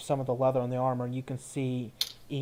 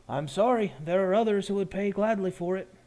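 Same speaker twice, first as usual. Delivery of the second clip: lightly voiced, higher shopkeeper; potion seller declining; 6 semitones higher, 4 dB louder than usual. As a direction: politely refuse the offer as the merchant. Potion seller refuses to go lower.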